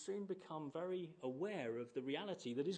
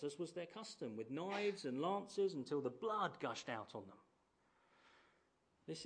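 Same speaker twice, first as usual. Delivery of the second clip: normal talking voice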